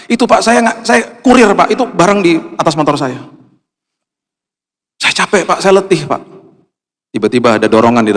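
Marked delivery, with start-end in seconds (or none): 0.1-6.2 high, pleading tired courier. Retold or imitated. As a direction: voice him high and worn out. (kurir yang capek, suara tinggi memelas)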